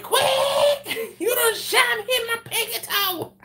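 high, loud, laughing shriek of pain; hammed-up shot-in-the-toe wail; shrieking woman. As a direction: squeal it out between laughs. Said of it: "She's like".

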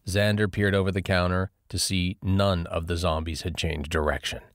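plain reading voice

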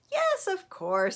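high, loud, drawn-out agreement; obliging crocodile. A narrator's reading.

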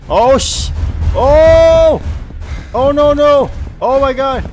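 high panicked pleading; screaming please don't corner me; terrified screaming. Real, not acted. Cornered by Bonnie.

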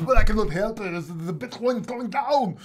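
high, loud mock market panic; MM Crypto. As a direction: overact the crypto doom announcement. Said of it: "the way he talks like this".

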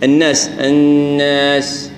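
الناس slow with the alif dragged through the nose; nasalized alif. Shown as a demonstration. Teacher mimics the over-nasal error in الناس. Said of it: "كأنك عندك غنة في كل الحروف".

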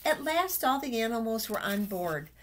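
usual speaking voice

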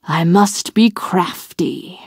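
slow, sly whisper; whispered scheme. A narrator's reading. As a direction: hiss it to yourself, plotting. Wolf's scheming private thought.

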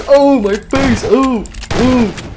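high, hammed-up yelp of pain; mock pain cry. Player hit in the face, high mock wailing. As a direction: wail as if shot in a game.

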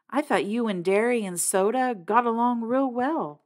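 ordinary delivery

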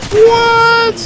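high, loud, shocked yelp; startled shout. Real, not acted. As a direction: jump out of your skin. Startled by something in game.

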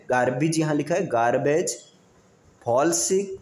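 ordinary delivery

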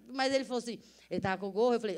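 ordinary talk, nothing marked

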